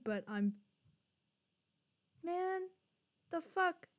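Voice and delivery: usual speaking voice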